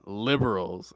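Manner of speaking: low, soft sigh into the word; sigh, pause, then grumble it; imitating a weary man's complaint; fed-up complaint. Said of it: "But he says".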